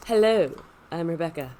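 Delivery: husky, low sick voice; sultry voice from a cold; sultry deep voice. Real, not acted. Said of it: "the sultry deep voice today".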